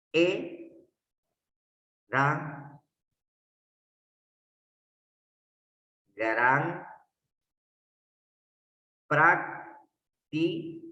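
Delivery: "e, da, gran, practi" each syllable said alone with long gaps; syllables split apart. Shown as a demonstration. Teacher separating the verse into syllables for students, slow and broken apart. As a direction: chop the line into slow separate beats. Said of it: "Voy a separar entonces".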